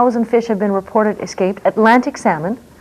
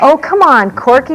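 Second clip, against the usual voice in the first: higher, louder real objection; exasperated protest; 4 semitones higher, 4 dB louder, slower than usual. Real, not acted. Host objects to guest calling it balderdash, exasperated protest. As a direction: cut in with indignant protest.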